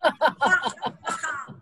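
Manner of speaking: loud hearty laughter. Pretend. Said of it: "Hearty laugh".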